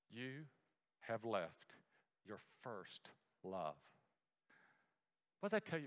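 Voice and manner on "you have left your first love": much softer and lower, slow; gentle rebuke. Acted. Jesus rebukes the church of Ephesus, soft and slow. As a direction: let it sink in almost under the breath.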